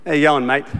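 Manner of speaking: broad Australian accent. Shown as a demonstration. Pastor demonstrates his Australian greeting for Americans, low broad accent. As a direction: lay the Australian accent on thick. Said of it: "I speak like this".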